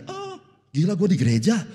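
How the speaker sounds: loud, high shocked gasp of waking in church; sudden loud comic shock; startled realisation. Retold or imitated. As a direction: jolt awake and realise where you are.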